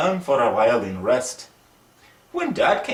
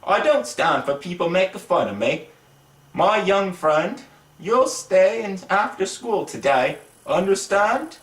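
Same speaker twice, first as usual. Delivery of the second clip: angry teacher; 6 semitones higher than usual. Acted. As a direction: lay down the punishment, mildly angry. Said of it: "made him very angry".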